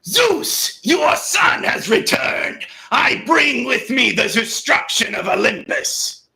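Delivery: loud, high, roaring warrior god; T C Carson's Greek Kratos. Acted. Kratos challenges Zeus, booming Greek Kratos shout. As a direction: roar it out as a returning vengeful son. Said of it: "I'll do one for Greek Kratos".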